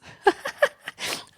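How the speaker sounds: high-pitched giggle at own joke. Real, not acted. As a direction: burst into a squeaky giggle.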